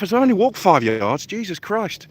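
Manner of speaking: panting between words after a short walk; breathless, catching air as you talk; out of breath. Real, not acted. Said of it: "out of breath".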